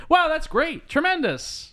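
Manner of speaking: high-pitched, gee-whiz cheerfulness; mock upbeat enthusiasm; chipper optimism. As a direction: gush it high, like a relentless optimist. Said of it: "like, chipper optimism thing".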